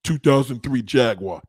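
a lightly mocking quote of a clueless commenter. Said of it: "when you hear a motherfucker just say".

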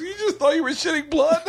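high, wheezy retold laughing line; imitating laughing friend. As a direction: act it out squeezed through laughter. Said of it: "Stanhope starts laughing".

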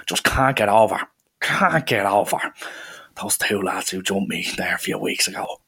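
lightly voiced tough taxi driver; rough taxi man. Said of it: "one of these kinda rough".

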